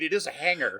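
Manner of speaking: normal talking voice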